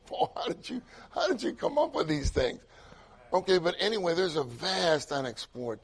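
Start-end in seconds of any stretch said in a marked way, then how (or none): 0.1-2.6 laughing wonder. Real, not acted.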